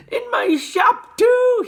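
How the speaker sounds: high, loud, slowed yell; excited yell. A narrator's reading. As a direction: yell it in triumph. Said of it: "he yelled".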